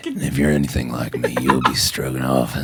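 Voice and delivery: a hint of a sultry sheriff; sexy cowboy voice. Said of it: "in a sexy cowboy voice".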